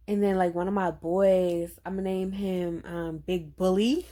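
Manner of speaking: ordinary talk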